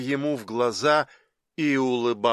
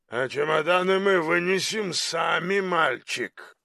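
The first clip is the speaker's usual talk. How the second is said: giant to porter; 2 semitones higher than usual. A narrator's reading. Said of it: "Тифон направился к багажу".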